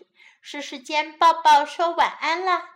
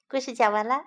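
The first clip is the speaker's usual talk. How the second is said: ordinary delivery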